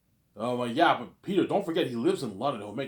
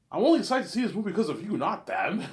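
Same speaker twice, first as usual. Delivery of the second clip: fast, high sales flattery; butter him up quickly; high rushed flattery; fast flattering pitch; 7 semitones higher, 4 dB louder than usual. Retold or imitated. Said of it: "Hollywood actors do you".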